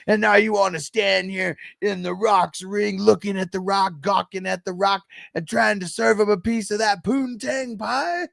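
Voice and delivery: mock trash-talking wrestler; The Rock impression. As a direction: cut a cocky wrestler promo. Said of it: "He was like".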